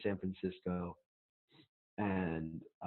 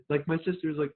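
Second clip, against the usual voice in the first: ordinary delivery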